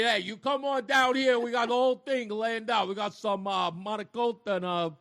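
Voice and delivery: hammed-up Italian-American uncle voice; Italian accent. Friend's husband inviting them over, in a hammed-up Italian accent. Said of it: "He talks like an Italian".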